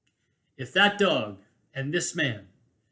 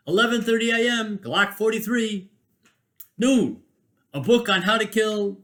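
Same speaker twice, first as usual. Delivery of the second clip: higher, announcer-style calling out of prizes; mock raffle caller; 5 semitones higher, 4 dB louder than usual. Mimicking a fire hall raffle caller announcing each prize, higher.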